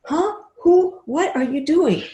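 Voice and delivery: high, shocked squeaks; aghast. Retold or imitated. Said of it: "Everybody else is aghast".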